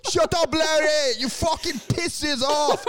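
mock Russian coworkers silencing Larry; mock angry shout. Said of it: "and they're like".

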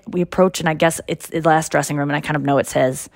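ordinary talk, nothing marked

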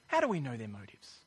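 ordinary talk

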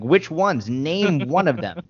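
exasperated challenge. Retold or imitated. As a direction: press them for an answer in disbelief. Retold argument demanding an answer.

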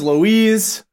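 normal talking voice

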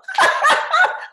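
sudden high laugh; loud laugh. Real, not acted. Laughing at own joke.

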